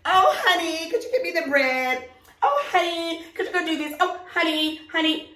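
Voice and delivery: high, sugary honey-calling; lightly voiced sugary wife; mocking a fake sweet wife. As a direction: overdo the sweet talk to show it's fake. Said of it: "even when you go to a function".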